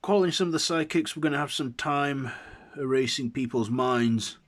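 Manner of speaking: loud, high, rapid report; field agent calling in. A narrator's reading. Foundation agent calling in psychics, loud brisk order. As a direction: call it out fast and high.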